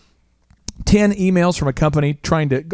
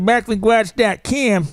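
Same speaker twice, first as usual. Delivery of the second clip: higher, hammed-up country twang, "com" as "cam"; country accent; 6 semitones higher, slower than usual. Teased about the accent, exaggerated country accent. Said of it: "country accent, brother?".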